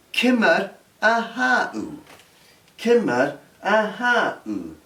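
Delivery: "Kimmer ahau" spoken slowly, stress on ahau, falling tune; slow, careful teacher model of "Kimmer ahau"; slow Gaelic demo. Shown as a demonstration. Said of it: "Do you want to try that?".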